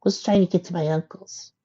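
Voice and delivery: high-pitched filly voice; hesitant little filly. A narrator's reading. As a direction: explain timidly as a small filly. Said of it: "trying to find her words".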